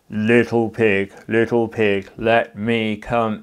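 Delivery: loud, slow growly wolf. Shown as a demonstration. Teacher demonstrating a wolf voice for the class. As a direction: drag it out like a big wolf at the door. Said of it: "use a wolf voice. Make it a bit lower".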